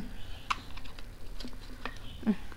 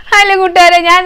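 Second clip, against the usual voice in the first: bright vlog greeting; 7 semitones higher, 8 dB louder than usual. Real, not acted.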